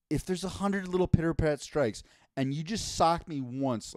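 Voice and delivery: ordinary talk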